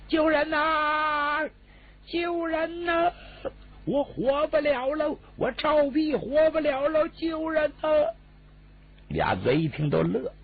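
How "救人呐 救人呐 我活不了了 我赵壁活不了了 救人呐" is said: high, slow, drawn-out yells for rescue; crying for help. Acted. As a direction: wail out for help at the top of the voice, much higher and slower. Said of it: "敞开嗓子就叫唤开了".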